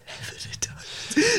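slow high whisper. Pretend. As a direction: whisper it back slowly. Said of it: "Why are you whispering it?".

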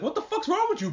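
shrill, high disbelieving outburst; mock outraged Legoshi. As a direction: squeal it in shocked disgust. Legoshi voiced, outraged at Bill.

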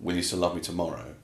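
a quiet moment before bed, hushed and low; intimate, serious. Shown as a demonstration. Carol asking her lover quietly and sensually before bed. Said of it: "I put a little bit of erotics".

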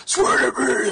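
hardcore high, strained growl; overdone loud superhero rasp, hammed-up; Batman. Mocking when he did that shit, hardcore growled yell. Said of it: "like, hardcore".

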